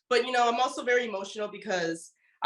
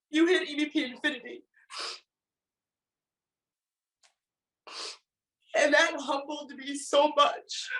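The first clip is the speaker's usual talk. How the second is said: crying; 8 semitones higher than usual. Real, not acted. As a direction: let the tears come as you say it. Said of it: "Why is she crying?".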